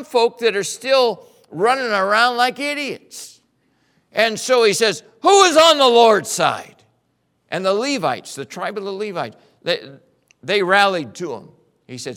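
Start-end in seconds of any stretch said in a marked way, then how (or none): 5.2-6.8 loud, high call to the crowd; shouted challenge, higher and louder; rallying shout. Acted. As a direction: cry out to gather the faithful. Moses rallies the camp.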